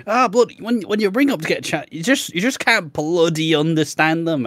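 high mocking bigot voice, a lightly put-on caricature. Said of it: "do the fake Chinese voice".

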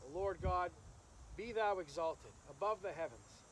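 ordinary delivery

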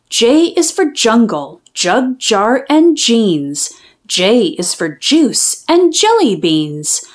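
usual speaking voice

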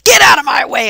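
sudden loud, high yell; retold shout. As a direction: shout it in furious determination. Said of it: "I just went".

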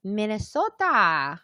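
put-on slow, drawn-out Minnesota accent. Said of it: "I do a Minnesota accent".

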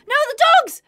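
high, loud yelp of alarm; panicked cry. Real, not acted. Player seeing her dogs attacked, panicked high yelp. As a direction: cry out as the dogs get hit.

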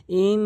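ইন with a loud, long nasal n; held ghunnah. Shown as a demonstration. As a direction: hum the n of ইন on one steady note. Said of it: "নাকের ভিতর নিতে হবে".

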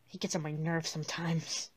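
low, very soft muttering; hushed, tired aside; weary grumble. Acted. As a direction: grumble under your breath. Grumbles alone after the argument.

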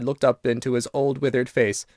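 ordinary delivery

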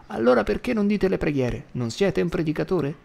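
put-on high, pushy old woman; mildly voiced bossy old woman; demanding grandma. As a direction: prod him like a bossy granny.

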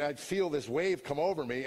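normal talking voice

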